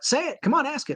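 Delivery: a lightly played coach daring him to ask; teasing coach voice. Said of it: "he's like".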